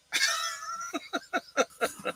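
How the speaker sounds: soft, high giggling laugh; high-pitched laugh. Real, not acted. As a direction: break into a high chuckle. Laughs at his own mock sympathy.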